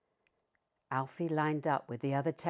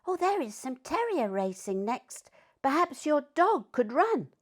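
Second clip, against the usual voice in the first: put-on higher childlike voice; child; 8 semitones higher than usual. A narrator's reading. Child telling Master about the terrier race, high and eager. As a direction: play an eager child, pitched up. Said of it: "One of the children looked at Alfie and said".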